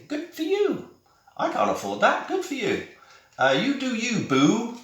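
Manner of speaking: high-pitched, sarcastic "good for you"; mock congratulation. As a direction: coo it in a very high mocking voice. Mock reply to boasting imagined commenters.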